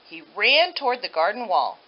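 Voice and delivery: ordinary delivery, nothing marked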